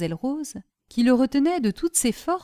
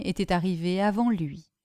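plain reading voice

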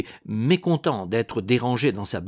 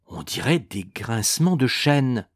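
ordinary delivery, nothing marked

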